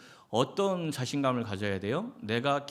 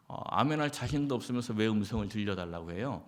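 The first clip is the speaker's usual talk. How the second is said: normal talking voice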